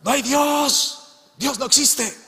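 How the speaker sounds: high, drawn-out mocking yell; a neighbour's high, stretched-out shout of denial; shouting atheist. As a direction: yell back across the fence, high and slow. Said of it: "en el momento él gritaba".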